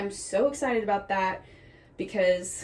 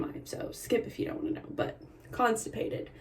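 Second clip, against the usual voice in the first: hushed, embarrassed whisper; whispered confession; 8 dB quieter than usual. Real, not acted. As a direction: whisper it like a secret. TMI confession.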